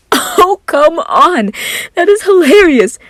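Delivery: high, loud delighted laughing exclaim. Acted. Virgil laughing at Roman, loud and high. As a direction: cackle it out.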